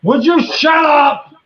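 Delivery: high, loud yell; exasperated shout. Real, not acted. Shouting at barking dogs mid-call, exasperated yell. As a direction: yell it out to silence them.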